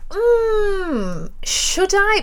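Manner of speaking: high-pitched, sceptical retort; mock doubtful. Musician answering a man's unwanted advice, high mock doubt.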